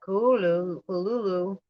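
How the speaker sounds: Kuhululu tried again, koo-loo-loo-loo; trying the pronunciation. Shown as a demonstration. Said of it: "said it like that".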